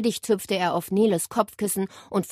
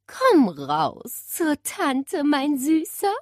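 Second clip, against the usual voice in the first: high, slow sing-song lure; sweet-talking aunt, drawn out; coaxing; 8 semitones higher, much slower than usual. A narrator's reading. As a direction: coax a sulking pet out of hiding. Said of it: "lockte sie".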